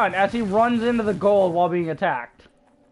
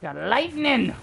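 ordinary talk, nothing marked

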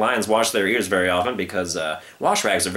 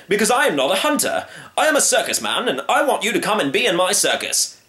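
circus man; 9 semitones higher than usual. Acted. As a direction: pitch it like a barker.

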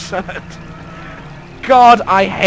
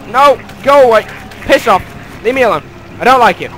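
louder, higher, frantic telling-off; shouting at enemies; 5 semitones higher, 4 dB louder than usual. Real, not acted. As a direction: shoo the fish away in mock panic. Fending off enemy fish.

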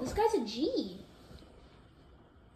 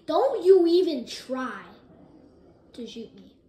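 mock threat; 8 dB louder, slower than usual. Threatening enemy player.

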